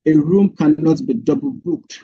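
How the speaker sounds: usual speaking voice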